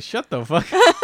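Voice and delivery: high, loud, laughing put-on dog voice. Voicing the dog sick of her owner's joy, laughing. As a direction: voice the annoyed dog through laughter. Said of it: "my dog is like".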